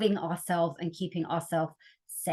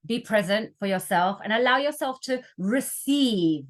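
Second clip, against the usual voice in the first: ordinary delivery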